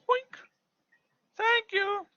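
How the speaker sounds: high puppet thanks. Pretend. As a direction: chirp it like a happy puppet. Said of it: "Uni asks Sophia".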